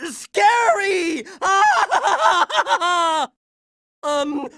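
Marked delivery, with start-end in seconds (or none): 1.3-3.3 hammed-up triumphant cackle; long gloating villain laugh; evil villain laugh. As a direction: cackle like a cartoon bad guy.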